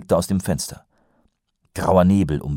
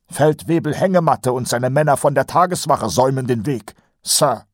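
higher, nasal-free eager sergeant voice; Kolon's voice; 8 semitones higher, 4 dB louder than usual. A narrator's reading. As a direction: play the chatty old sergeant, pitched up. Colon voiced by narrator. (höher gesprochener Feldwebel Kolon)

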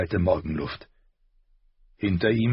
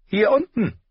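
higher, louder call from below; 5 dB louder than usual. A narrator's reading. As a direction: shout up to the big man. Tyrion calls up.

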